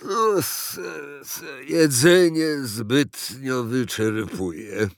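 slow, halting, ponderous character voice; weary fat president. A narrator's reading. President explains pills to uncle, slow and weary. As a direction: labour through it, stammering.

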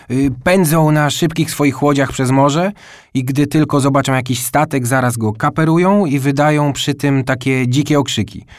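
lightly voiced boy full of pirate lore; eager storytelling boy. A narrator's reading. Boy telling his little brother about corsairs, eager.

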